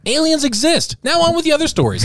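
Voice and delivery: bright TV anchor read; news anchor. Pretend. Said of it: "every news story should be like".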